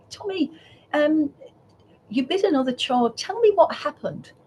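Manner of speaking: gentle teacher. Retold or imitated. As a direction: coax the child to open up.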